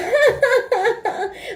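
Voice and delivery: imitating a high mocking laugh; a lightly done mock cackle. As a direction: mimic her laughing in your face. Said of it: "she was laughing in my face".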